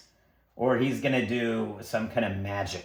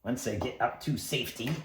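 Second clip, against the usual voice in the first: ordinary talk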